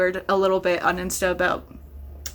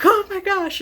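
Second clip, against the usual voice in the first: excited gasp; 10 semitones higher than usual. Retold or imitated. Excited reaction to Easter egg.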